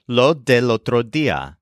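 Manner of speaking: "lo del otro día" with each D fully sounded; expected textbook pronunciation. Shown as a demonstration. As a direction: say "lo del otro día" carefully, every D clearly pronounced. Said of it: "the D almost disappears".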